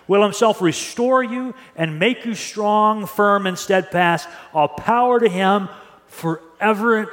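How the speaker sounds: slow, lingering reading of scripture; unhurried, reverent read-aloud. Shown as a demonstration. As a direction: let each phrase sink in slowly. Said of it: "I'm gonna read it slowly".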